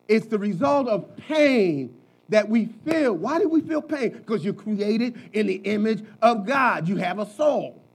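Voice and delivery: usual speaking voice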